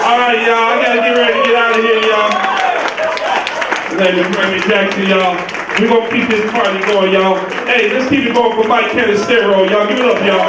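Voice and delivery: loud, fast club host hyping the crowd; host announcer. Acted. Club host introducing the next comic, loud hype. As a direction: pump up the room like an emcee. Hosts the comedy show.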